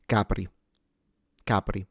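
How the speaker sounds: Capri stressed on the first syllable, light trill; Italian Capri. Shown as a demonstration. Teacher modelling Capri again for learners. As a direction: say Capri plainly the Italian way, CA-pri. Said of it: "But in general".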